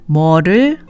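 ordinary talk

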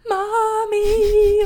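high, loud, drawn-out wail; imitated child's yell. Flower girl yelling all the way down the aisle, imitated wailing yell. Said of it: "one of them was just yelling".

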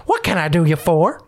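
a hint of an old frontier prospector; folksy old miner greeting. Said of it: "an old prospector".

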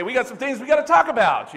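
cheerful menace before a spanking; mock grinning parent. Parent with a belt grinning at the kids, cheerfully menacing. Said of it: "big old smile on your face and say".

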